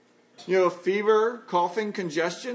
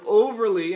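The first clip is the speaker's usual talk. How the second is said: "overly" hit louder than the rest; emphatic, louder "overly"; stress on overly; 6 dB louder than usual. Real, not acted. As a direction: punch the word "overly". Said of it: "and I emphasize that word".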